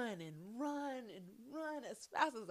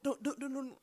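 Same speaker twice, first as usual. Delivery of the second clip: ordinary delivery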